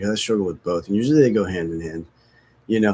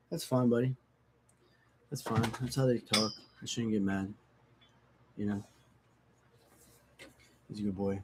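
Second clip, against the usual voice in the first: much softer, calm reassurance; soothing the dog; 11 dB quieter than usual. Real, not acted. After yelling at his dog, a soft calming murmur. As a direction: settle the dog gently.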